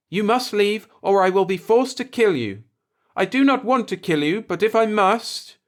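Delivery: put-on high, grave voice of an old alien native; old native's warning. A narrator's reading. Old native warns the Earthman to leave, grave and high.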